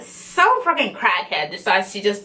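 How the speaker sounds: usual speaking voice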